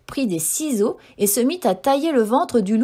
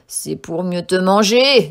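lower, slow, louder wolf menace; wolf springing his trap, slow and louder; menacing wolf; 4 semitones lower, 6 dB louder, slower than usual. A narrator's reading. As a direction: deliver the final threat. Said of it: "dit le loup".

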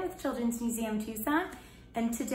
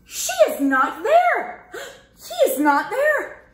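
worried searching; 11 semitones higher, 8 dB louder than usual. Acted. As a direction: search anxiously.

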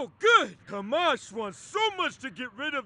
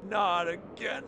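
ordinary delivery

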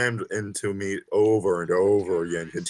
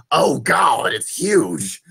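high, loud hammed-up gasp of shock; mock shocked exclamation; 8 semitones higher, 9 dB louder, much slower than usual. Reading fan fiction aloud, mock shocked high exclamation. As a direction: shriek it in fake amazement. Reader hams up narrator's exclamation.

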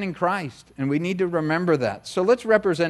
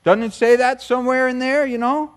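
mock excuse-maker; 7 semitones higher, 4 dB louder than usual. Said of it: "they say".

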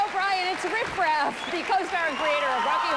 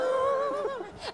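high laugh; 13 semitones higher than usual. Real, not acted. Host laughs at guest.

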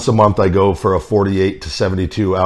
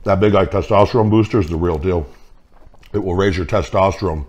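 speaking around a sandwich; talking while chewing. Real, not acted. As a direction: mouth full of food. Seen 4: sandwich held at lips, chewing between words throughout.

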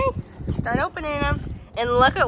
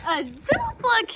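higher, slower disbelieving exclaim; surprised; 10 semitones higher than usual. Real, not acted. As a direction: stretch it out in amazement. Surprise at a duplicate.

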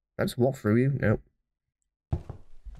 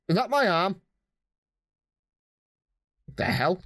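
ordinary talk

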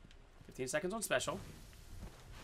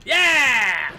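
loud high cheer; victory yell; 5 semitones higher, 12 dB louder than usual. Real, not acted. Gamer gets the kill, loud victory cheer. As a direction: shout the win. Wins a fight.

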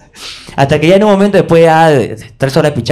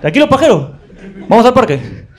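uncle laughing at him; 6 semitones higher, 4 dB louder, slower than usual. Retold or imitated. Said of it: "Me dijo".

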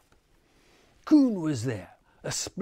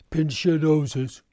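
loud, higher warning; warning call; 4 semitones higher, 6 dB louder than usual. A narrator's reading. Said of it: "warned he".

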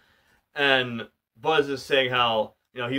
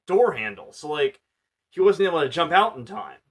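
ordinary talk

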